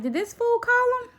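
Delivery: high, mocking disbelief; incredulous inner thought. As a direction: squeak it out in outraged disbelief. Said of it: "So I'm like".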